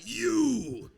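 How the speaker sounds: loud, high swooping 'you'; crowd vowel sound. Retold or imitated. Comic imitating other audiences, loud high whoop. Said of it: "Some are like".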